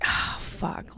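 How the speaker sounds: unvoiced soft curse; whispered swear. Shopper spotting the dented cup, whispered curse. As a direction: hiss it under your breath. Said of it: "And I was like".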